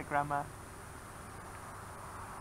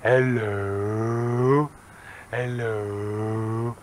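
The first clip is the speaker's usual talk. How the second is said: slow hello; 2 semitones lower, 3 dB louder, much slower than usual. Shown as a demonstration. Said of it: "you have to say it slowly".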